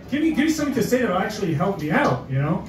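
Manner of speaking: ordinary talk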